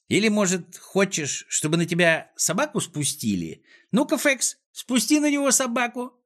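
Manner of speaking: high-pitched taunting boss voice; high, goading character voice; menacing villain. Acted. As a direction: threaten with gleeful relish, pitch climbing. Klops threatens to set dog.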